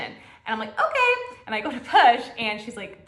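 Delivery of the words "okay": sudden high squeak of an okay; a startled, much higher little okay; high squeaky okay. Retold or imitated. Her own reply retold, squeaky.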